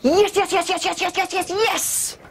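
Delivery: high, thrilled chant of yes; pumped-up happy chant; amped-up yeses. Shown as a demonstration. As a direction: build the joy, bouncing the yeses. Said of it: "If you want to amp it up".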